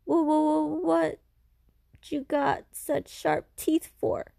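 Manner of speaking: frightened stammer. Acted. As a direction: stutter in fear at the creature. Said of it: "The boy is so frightened".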